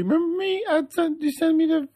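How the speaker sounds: high squeaky impersonation; Janelle voice. Pretend. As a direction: voice her like a giddy star.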